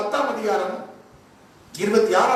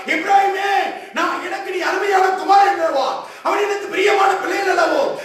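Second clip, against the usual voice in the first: higher, urgent preaching in God's voice; full-force preaching; 9 semitones higher than usual. Acted. Preacher voicing God calling to Ephraim, full-force preaching. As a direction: call out to Ephraim as a pleading father.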